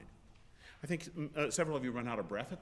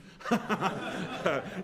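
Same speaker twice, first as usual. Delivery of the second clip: laugh; 6 semitones higher, 8 dB louder than usual. Real, not acted. Conductor amused by the singers.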